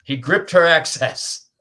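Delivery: slow, hammed-up steamy prose; mock romance narration. Host turning a file request into mock romance narration. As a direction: ham it up like a torrid paperback, breaking into laughs.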